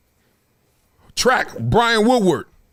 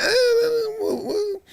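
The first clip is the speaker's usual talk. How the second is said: high, fake crying eh eh; mock whimpering; 4 dB quieter than usual. Fans mock-whining with nothing to criticize, high and teary. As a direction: pretend to whine like a crybaby. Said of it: "We're like".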